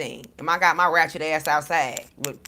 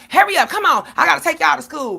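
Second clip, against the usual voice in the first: loud, high-pitched irritated yell, re-enacted; mad, rushing the kids; 9 semitones higher, 8 dB louder than usual. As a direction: holler impatiently like a frazzled parent. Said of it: "'cause I'm all mad at him".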